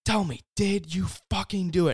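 high-pitched, imitated frantic mother pressing for an answer; frantic demand. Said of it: "you could tell she's all frantic".

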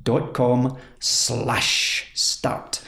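very slow DOT COM SLASH START, each word set apart; heavy emphasis. Real, not acted. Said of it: "emphasise the words a bit better".

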